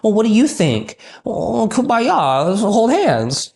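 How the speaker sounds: high, slow, syrupy pushover voice; mock a leader who's too nice to lead. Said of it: "You can't just be like".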